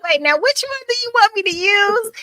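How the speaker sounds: high, giddy retold question, laughing through it; playful mock confusion. Host telling guest she couldn't pick a nickname, giggly and high. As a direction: ask it like you're spoiled for choice. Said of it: "I said".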